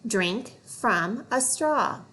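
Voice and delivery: "Drink from a straw" drawn out slowly; slow fluency read. Shown as a demonstration. Teacher reading a fluency phrase slowly.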